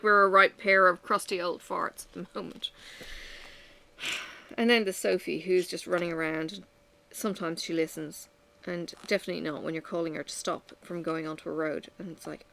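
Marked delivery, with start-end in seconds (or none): none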